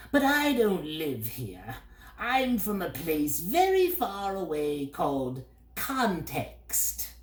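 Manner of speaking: high, slow bird voice, dreamy; wistful bird. A narrator's reading. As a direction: boast of far-off home as the bird. Bird figure.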